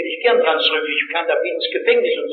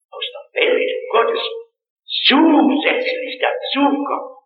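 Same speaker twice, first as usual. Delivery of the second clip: usual speaking voice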